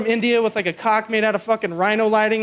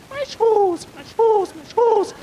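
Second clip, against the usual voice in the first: much higher, repeated cooing wail; pigeon-like fretting; 12 semitones higher, slower than usual. Acted. Distracted wife worrying over her shoes, pigeon-like fretting. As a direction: coo the words like a flustered pigeon.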